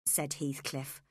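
plain reading voice

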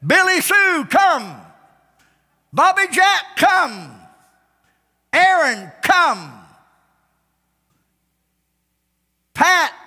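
high, loud holler that drops on "come"; calling out. Acted. Preacher acting out a shepherd calling his sheep by name, hollering. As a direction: holler the names like calling in livestock.

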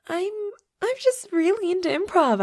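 high, loud, over-eager excuse; nervous cover-up. Acted. Vampire covering a slip to human roommate, nervously high.

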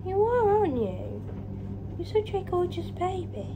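high-pitched coo to the cat; doting pet talk. Real, not acted. Cooing praise at her cat.

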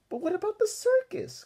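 high, loud upset cry; wailing protest. Acted. D W crying to Mother about missing the circus, high and loud. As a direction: wail it in dismay. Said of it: "cried D W".

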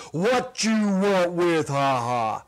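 loud, slow, menacing big-man voice; gruff giant's demand. Acted. Giant cafe owner demanding what two strangers want, loud and slow. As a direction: loom over the kids and demand it slowly. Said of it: "demands to know".

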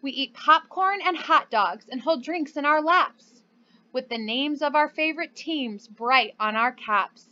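ordinary talk, nothing marked

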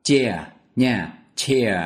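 "chair" said like Vietnamese "che", falling; teacher modelling "chair". Shown as a demonstration. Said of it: "các bạn đã nhớ được phát âm từ vựng này chưa".

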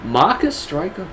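slow catchphrase-style character voice; Ace. Pretend. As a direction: stretch the name out in a high put-on voice. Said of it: "Do your Ace".

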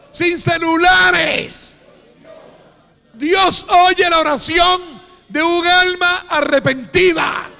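loud, slow, stretched preacher cry; preaching at full force. Real, not acted. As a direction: hammer each phrase slowly at full volume. Clímax de la predicación. (predicación a gritos, lenta y estirada)

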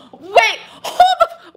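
loud, slow, laughing outburst; shocked laughing shout. Real, not acted. Gasp then laughing shout.

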